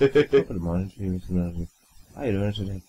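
low-voiced stage Irish greeting; Irish accent. A second try at the greeting, low Irish accent. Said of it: "worst Irish accent".

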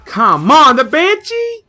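high, loud cry of disbelief; exasperated outburst. Real, not acted. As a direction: yell in disbelief, pitch shooting way up. Loses to Banshee in game.

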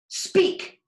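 sharp high command to the frog; commanding Davy. Acted. Boy ordering his frog to talk, sharp high command.

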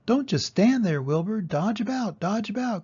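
high, loud honking goose voice; bossy goose shouting orders; the goose urging Wilbur to run, high and loud; goose crying out. A narrator's reading. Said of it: "cried the goose".

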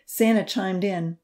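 ordinary delivery

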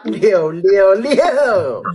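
drawn out. Acted. Drawn-out "666" in a chat.

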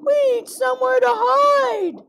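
very high frightened fish voice; panicked plea. Acted. Maxwell begs Birdie for a hiding place, high panicked voice. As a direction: plead urgently, high-pitched.

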